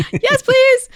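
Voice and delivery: squealing with joy, laughing; thrilled yes. Retold or imitated. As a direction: gush like you've just been handed a dream. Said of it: "And I was like".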